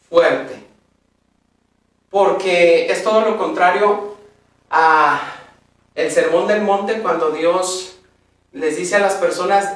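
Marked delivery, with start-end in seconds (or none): none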